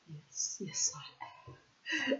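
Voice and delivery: sheepish whisper, drawn out; guilty little whisper; whispered reply. Retold or imitated. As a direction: whisper it like a caught-out boy. The lad's meek reply.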